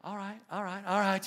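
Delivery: hammed-up tuneless singing voice; mock a singer who can't carry a tune; playful tease of a bad voice; off-key singer. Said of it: "other voices like".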